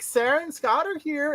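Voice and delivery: high, mock-chirpy wife voice; mimicking his wife. Imitating his wife calling him to greet guests. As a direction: lift it up like an eager wife announcing guests. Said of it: "And then Meredith's like".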